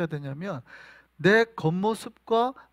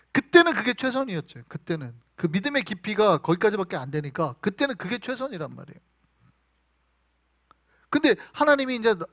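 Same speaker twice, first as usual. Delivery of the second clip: ordinary talk, nothing marked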